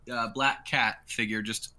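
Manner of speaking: ordinary talk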